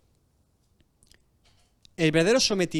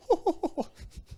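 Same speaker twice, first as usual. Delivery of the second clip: stifled laugh; 12 semitones higher, 3 dB quieter than usual. Pretend. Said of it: "Se ríe para sí".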